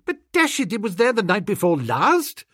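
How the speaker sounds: put-on high indignant young gentleman; flustered toff. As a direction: protest like a flustered aristocrat. Bertie protests.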